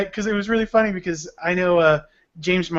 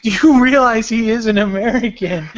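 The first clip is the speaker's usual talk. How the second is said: higher-pitched, laughing disbelief; amused, incredulous comeback; incredulous mock retort; 5 semitones higher, 4 dB louder than usual. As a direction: throw it at the clueless critics, half laughing. Mocking online critics, laughing.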